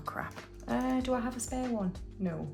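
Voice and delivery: usual speaking voice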